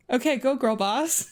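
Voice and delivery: ordinary delivery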